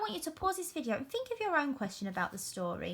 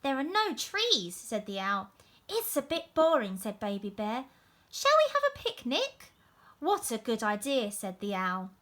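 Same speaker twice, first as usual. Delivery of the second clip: put-on lively storybook narration for young pupils; story reading to children. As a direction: read it out like a teacher at story time. Reads picture book aloud.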